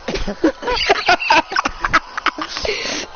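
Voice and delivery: loud, high cackling fit; villain's laugh. Acted. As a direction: cackle at your own cruel joke. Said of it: "he's just laughing".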